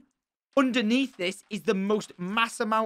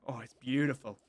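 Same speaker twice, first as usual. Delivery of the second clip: ordinary talk, nothing marked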